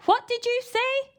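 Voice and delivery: high, loud questioning granny; high loud old lady query; deaf granny demanding. A narrator's reading. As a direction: shout it like she can't hear. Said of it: "demanded the old lady".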